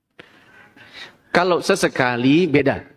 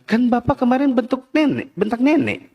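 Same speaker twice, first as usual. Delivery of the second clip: put-on child answering back; 7 semitones higher than usual. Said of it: "Kata anaknya".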